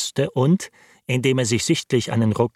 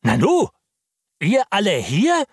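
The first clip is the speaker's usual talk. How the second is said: louder, higher, indignant question; displeased; 9 semitones higher, 5 dB louder than usual. A narrator's reading. Lawyer rebukes the servants in an indignant tone. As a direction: scold the gathered servants. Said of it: "in ungehaltenem Ton".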